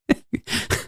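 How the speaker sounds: a short, soft laugh at his own pun; soft chuckle after the pun; quiet laugh. Real, not acted.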